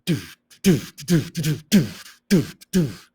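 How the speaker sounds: doof noises in a steady pulse; short punchy doof beats; beatboxed beat. Shown as a demonstration. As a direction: thump out a beat with the mouth. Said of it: "rhythm is like the beat".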